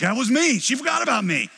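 loud, high hurt protest; aggrieved outburst. Retold or imitated. Listener protesting to God, loud and hurt. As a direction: cry out that she forgot you. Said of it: "And you're like".

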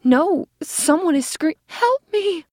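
high, loud desperate cry for help; terrified cry. A narrator's reading. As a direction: scream for help in terror. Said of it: "cried the distinctly feminine voice".

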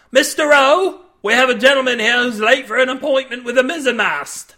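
captain calling Rowe to flog Cade; loud mocking call. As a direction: summon Rowe with glee. Captain calls for a flogging.

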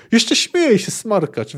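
put-on high outraged outburst from an old wizard; indignant exclamation. A narrator's reading. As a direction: exclaim it, scolding a cheeky youngster. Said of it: "wykrzyknął Ortolan".

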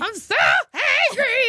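high, slow crying whine; hangry whine. Pretend. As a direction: wail like a starving child. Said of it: "I'm hangry".

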